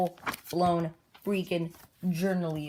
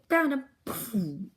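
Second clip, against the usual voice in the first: raised, higher story-voice exclamation; acted triumphant call; 6 semitones higher, 5 dB louder than usual. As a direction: burst in calling it out. Acted shout as character bursts in.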